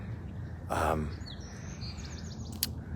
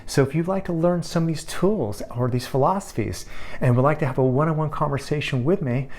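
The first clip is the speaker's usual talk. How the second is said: higher, upbeat promo voice; ad read; 6 semitones higher than usual. Acted. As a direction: sell the free call with cheer.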